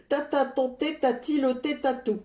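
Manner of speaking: second go at "Tata, ton thé, ta-t-il ôté tatou?" through a laugh; laughing retry. Shown as a demonstration. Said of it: "Je répète plusieurs fois, de plus en plus vite". (virelangue repris en riant)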